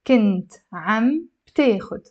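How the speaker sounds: slow word-by-word teaching delivery with gaps; 放慢示范. Shown as a demonstration. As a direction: say each word separately for learners. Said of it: "Let me say it slowly".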